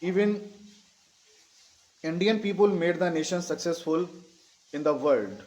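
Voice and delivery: very slow, with long pauses between phrases; slow dictation. Shown as a demonstration. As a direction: dictate piece by piece, leaving time to write. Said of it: "धीरे धीरे बोल रहा हूँ". (रुक-रुककर धीरे-धीरे लिखवाते हुए)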